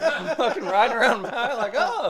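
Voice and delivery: bursting into laughter while he tells it; laughing. Real, not acted. Laughing while he tells his friend about Maui.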